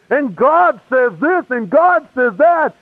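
high, slow, singsong preacher swoops; mock fervent preacher. Imitating the preacher walking around the room, mock fervent preaching. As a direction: stretch each phrase like a storming preacher. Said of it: "he's walking around going".

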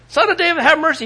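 high, loud pleading call. Retold or imitated. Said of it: "He cries out what? All the more".

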